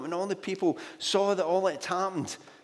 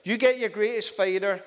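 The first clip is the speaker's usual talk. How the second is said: Goliath's taunt; 6 semitones higher, 5 dB louder than usual. Retold or imitated. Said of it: "because I'm Goliath".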